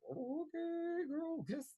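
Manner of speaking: high, soft, drawn-out mock amazement; impressed reaction. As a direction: coo it high and quiet, impressed.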